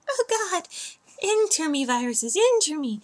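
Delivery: high, breathy mock seduction; breathy seductive voice. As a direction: moan it like a seductive invitation. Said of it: "I don't think Firefox is like".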